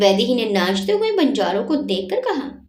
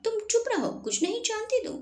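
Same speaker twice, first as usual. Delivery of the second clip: sulky retort; 12 semitones higher, 8 dB quieter, faster than usual. Acted. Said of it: "Vaidehi मुंह बनाकर बोली".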